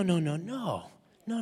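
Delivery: ordinary delivery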